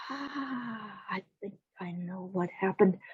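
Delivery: soft, breath-led dawning thought; hushed realisation. Acted. Jack works out what happened at the mill, hushed. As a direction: start on a long quiet sigh, then think aloud softly.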